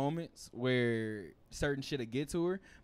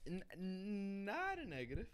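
voice cracking; 7 semitones higher, 8 dB quieter, slower than usual. Real, not acted. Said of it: "you hear my voice crack?".

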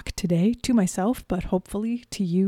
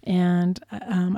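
ordinary talk

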